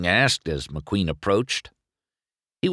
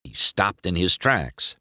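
plain reading voice

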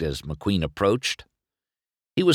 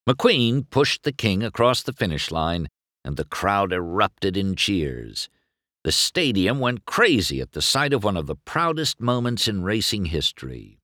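ordinary delivery, nothing marked

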